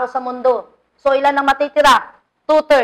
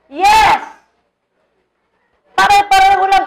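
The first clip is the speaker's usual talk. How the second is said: emphatic preaching; 6 semitones higher, 5 dB louder than usual. Real, not acted.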